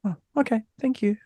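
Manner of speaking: high, soft little reply; imitating accepting son. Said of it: "but he was like".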